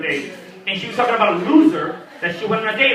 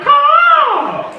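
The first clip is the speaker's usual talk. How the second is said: loud, high eagle screech; eagle caw; 6 dB louder than usual. Retold or imitated. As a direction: screech like an eagle, full volume. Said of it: "make noises like an eagle".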